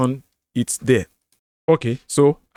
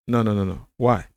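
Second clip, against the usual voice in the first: ordinary delivery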